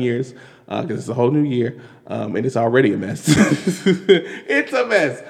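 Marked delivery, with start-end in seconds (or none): 3.3-4.0 a quick real laugh; a short amused laugh. Real, not acted. Laughs at the mess. 4.1-5.2 it's a mess said slowly through a laugh; slow, amused, laughing delivery. Real, not acted.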